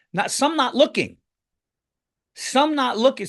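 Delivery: can you believe it, rising up; indignant disbelief. Real, not acted. Excited retelling of crowd.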